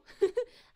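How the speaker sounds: a sudden, loud, high laugh; loud burst of laughter. Real, not acted. Delighted greeting to chat.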